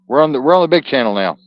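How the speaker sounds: fast, loud, high burst of relief; excited outburst. Real, not acted. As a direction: blurt it out, overjoyed to be back on. Excited relief after reconnecting.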